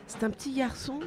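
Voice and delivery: a hint of a young girl; lightly voiced girl. A narrator's reading. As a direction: pitch it up for a child's answer. Sylvie's line voiced in story.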